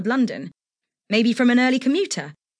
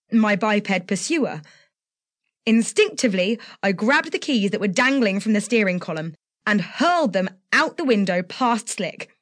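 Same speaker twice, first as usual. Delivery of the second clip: ordinary delivery, nothing marked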